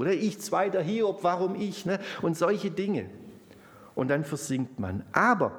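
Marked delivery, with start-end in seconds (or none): 0.1-1.9 mock self-pity. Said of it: "Portion Selbstmitleid".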